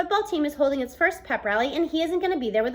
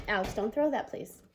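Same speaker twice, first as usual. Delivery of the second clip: quiet aside; 3 semitones lower, 7 dB quieter than usual. Real, not acted. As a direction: hush it under your breath. Reader tells a child to stop.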